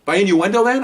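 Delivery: officer firing back at his captain, sarcastic; sarcastic comeback. Retold or imitated. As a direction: throw it back at them. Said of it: "I mean, I'm hot".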